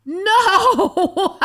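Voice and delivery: loud, high, disbelieving cry breaking into laughter; real scandalised howl; shocked laughing outburst. Real, not acted. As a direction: shriek it and crack up. Co-host reacts to pinched ass.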